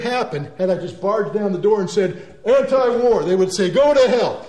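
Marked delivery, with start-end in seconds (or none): 2.4-3.2 loud, high blunt slogan; mock protester shout. Barging in with slogan. 3.7-4.5 loud, high gruff dismissal; hammed-up angry veteran; mock angry retort. Legionnaires retort.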